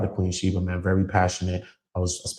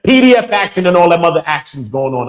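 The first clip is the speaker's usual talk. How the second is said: loud, higher angry rant; angry shout; 10 semitones higher, 13 dB louder than usual. Real, not acted. Warning the men who exploit girls, loud angry shout. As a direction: blast it out in outrage.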